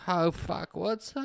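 soft, breathless-style mock swoon; swooning women. Women asking about the kiss, soft mock swoon. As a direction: ask it softly like a smitten fan. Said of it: "They're like".

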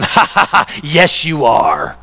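slow, gloating fake ha-ha-ha; mocking laugh. Imagined Jesus taunting a sinner headed toward hell, mocking. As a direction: taunt them with a drawn-out mock laugh. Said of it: "he's not laughing going".

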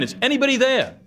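high, desperate call into the void; yelling again. Retold or imitated. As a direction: shout up the cliff, pleading. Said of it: "And then he yelled again".

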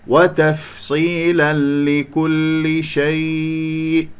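وتفصيلا لكل شيء slowly chanted, cut off firmly on the ء; stressed hamza stop. Shown as a demonstration. Teacher showing a stop on hamza, slow recitation ending in a firm catch on شيء. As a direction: draw it out, then close sharply after شيء. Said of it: "هكذا إذا أقف على الهمزة أقف بالنمر".

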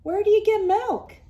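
puzzled question. Acted. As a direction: wonder aloud, a bit silly. Hank puzzled.

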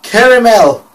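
high, loud "caramel" as "KERRY-mel"; mock her "caramel", first syllable like "care"; punched-up "caramel", middle vowel turned to "ee". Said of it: "That word".

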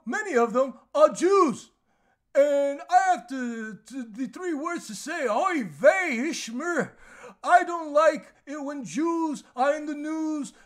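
high, loud mock monologue voice; mocking Larry David impression. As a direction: ham up the comic's delivery to ridicule him. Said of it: "this is how he talks".

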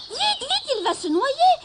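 high, loud panicked cry; girl screaming. A narrator's reading. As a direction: yell it like a frightened little girl. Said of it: "hurla une petite fille".

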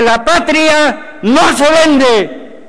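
slow, high, ringing rallying cry; rousing slogan. Real, not acted. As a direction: proclaim it slowly, as a rallying cry. Closing patriotic slogan in a speech.

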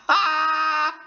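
long, sustained high-pitched squeal; high excited squeal. Real, not acted. Fan overwhelmed by a voice discovery, squealing. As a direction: squeal helplessly on one held high note.

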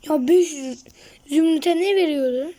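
a loud, incredulous question. Real, not acted. Shouting question about the trade.

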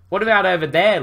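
mild shout pointing to a cavern. Acted. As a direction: call out across the noise. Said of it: "Luke shouted".

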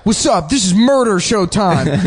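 high, pumped-up showman greeting; hype announcer. Retold or imitated. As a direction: hype it like a subway dancer. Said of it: "They're like".

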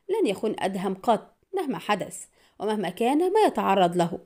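plain reading voice

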